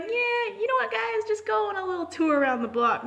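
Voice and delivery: high, loud cheerful doorman voice; chirpy tip from the bouncer; friendly doorman. Retold or imitated. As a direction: bouncer suggesting a walk, in a high bright voice. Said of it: "he was like".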